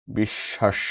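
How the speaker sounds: বিশ্বাস said as "bishshash"; বিশ্বাস, softly, as "bishshash"; spoken form. Shown as a demonstration. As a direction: double the sh in বিশ্বাস, silent b. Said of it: "শব্দের উচ্চারিত রূপ হয়".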